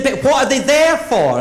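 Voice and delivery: put-on high, loud little boy; wide-eyed four-year-old; child's voice. Nephew asking auntie, high child voice.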